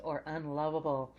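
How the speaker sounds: normal talking voice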